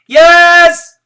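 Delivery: very high, loud holler. Acted. Kids hollering back to the teacher, very loud. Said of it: "we hollered back".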